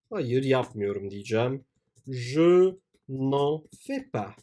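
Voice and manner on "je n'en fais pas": slow dictation. Shown as a demonstration.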